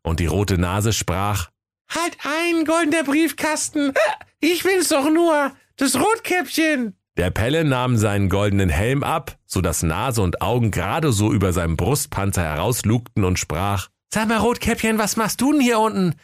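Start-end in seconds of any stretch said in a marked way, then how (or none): 1.9-6.9 put-on tipsy girl voice. 14.1-16.1 put-on high boy voice. Pelle speaks, held voice.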